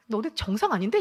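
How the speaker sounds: loud mock-indignant challenge. Woman voicing what she never told the congregation, indignant. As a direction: throw out the unsaid retort, amused. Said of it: "화가".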